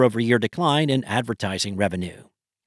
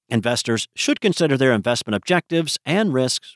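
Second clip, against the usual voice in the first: quick, even compliance read; fast legal disclaimer. Real, not acted. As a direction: rattle off the fine print quickly. Legal disclaimer read at podcast end.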